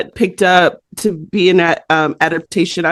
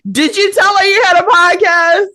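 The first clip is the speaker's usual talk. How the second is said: excited call; 10 semitones higher than usual. Retold or imitated. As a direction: call it out eagerly. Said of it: "Ashley's like".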